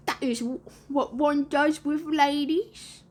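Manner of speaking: slow, high put-on boy voice; character voice, slow and self-important; know-it-all lost boy. A narrator's reading. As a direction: draw it out, climbing high at the end.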